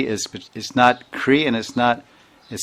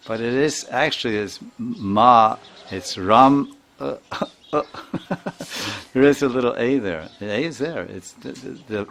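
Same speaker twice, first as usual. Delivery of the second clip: ordinary delivery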